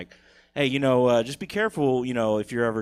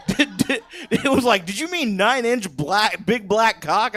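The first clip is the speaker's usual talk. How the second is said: mock search engine voice; 11 semitones higher, 8 dB louder than usual. Pornhub voiced as a mocking search.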